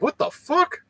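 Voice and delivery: high, stunned what the fuck; retold shock. Telling of brothers walking in unannounced, shocked. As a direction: jump up high in disbelief. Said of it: "And I'm like".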